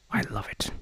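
breathy little whisper of delight; whispered aside. Real, not acted. Reviewer whispering his love for the mic, softly. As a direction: drop to a whisper, just for yourself.

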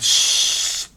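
loud shushing; hushing. Retold or imitated. Crowd hushing the blind man. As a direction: hush him sharply. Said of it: "orang mungkin berkata".

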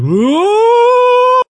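very high, loud cartoon whoa; hammed-up Jim Carrey whoa; mock whoa. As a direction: yelp it like a rubber-faced comic. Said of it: "I'm always just like".

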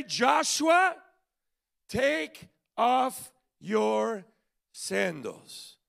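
a heavenly order, drawn out; commanding call. Retold or imitated. Commander ordering Joshua, loud then slow solemn command.

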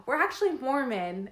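spring the fake news brightly; excited. Repeating the joke louder.

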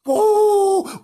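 high, loud dog howl; wailing howl. A narrator's reading. Hungry Frank howling back at Bernard, loud wail. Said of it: "wailed Frank".

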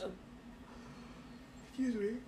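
yawning through the words. Real, not acted. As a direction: say it mid-yawn, stretched. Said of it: "pardon my yawn".

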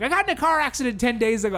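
high-pitched mild mock yell; retold scream. Concertgoer defending himself to a heckler, mock yelling. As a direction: imitate a flustered shouted defense. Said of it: "I actually screamed".

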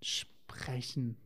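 soft unvoiced whisper of the right word; a hushed aside correcting her; whispered correction. Real, not acted. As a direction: quietly slip in the correct word.

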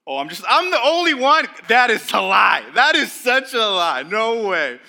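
loud, high teasing shout of protest; mock outrage. Real, not acted. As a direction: yell it playfully at the crowd.

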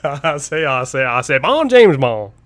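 Foghorn Leghorn-style Southern bluster; bad Southern accent. Host imagines the actor introducing himself as Bond, bad Southern accent. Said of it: "in a Southern accent".

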